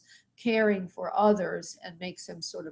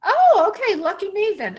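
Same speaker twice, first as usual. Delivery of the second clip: pleasantly surprised; 12 semitones higher, 12 dB louder than usual. Real, not acted. Caller arrives, reader delighted.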